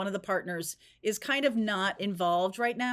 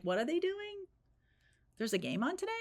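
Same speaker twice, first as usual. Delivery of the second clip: airy puzzled question; 8 semitones higher, 6 dB quieter, faster than usual. Retold or imitated. Voicing a disinterested former fan.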